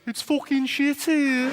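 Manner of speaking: high, slow mock Brummie; exaggerated Brummie complaint, drawn out; Birmingham accent. Shown as a demonstration. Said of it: "phrase that seems to come up".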